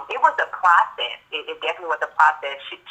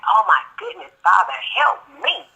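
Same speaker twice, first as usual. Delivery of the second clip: retold exasperated plea; 9 semitones higher than usual. Stepmother praying to Father for help, exasperated plea. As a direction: plead upward in mock desperation. Retold desperate prayer amid horrible abuse.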